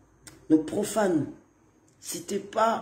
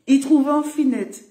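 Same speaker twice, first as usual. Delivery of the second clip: amused exclamation; 7 semitones higher, 7 dB louder, slower than usual. Pretend. Repeats the phrase for fun.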